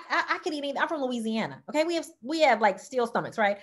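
ordinary talk

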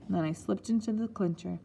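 usual speaking voice

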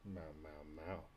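soft playful meowing at the cat; imitated meows. Real, not acted. Owner meowing softly to her cat. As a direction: meow back at the kitty.

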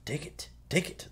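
eerie, softly squeaked temptation with rising ends; creepy. Retold or imitated. Clown luring the little boy with his lost boat, creepy. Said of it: "he does that, and it's really creepy".

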